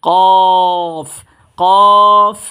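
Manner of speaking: কফ with a full heavy throat sound; heavy Qaf. Shown as a demonstration. Teacher demonstrating the heavy Arabic letter Qaf. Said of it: "মোটা আওয়াজে পড়ব".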